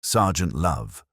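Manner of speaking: usual speaking voice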